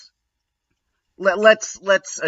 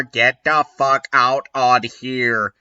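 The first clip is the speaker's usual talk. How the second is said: normal talking voice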